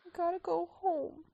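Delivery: high, pleading pup voice; playful high character dub; voicing film animal. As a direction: play a whimpering youngster begging. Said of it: "Doing that narrate thing again".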